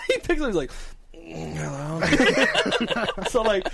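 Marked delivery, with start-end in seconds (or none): 1.1-3.0 very slow, drawn-out sleepy hello; man in bed. Retold or imitated. Friend picks up the phone in bed, sleepy. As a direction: imitate someone answering half asleep. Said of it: "picture him just in bed".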